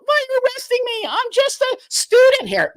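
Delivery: high, fast whiny panic; mock panicked student. Protester being arrested mocked in a high panicked voice. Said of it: "they're freaking out".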